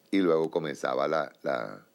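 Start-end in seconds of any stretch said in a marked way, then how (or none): none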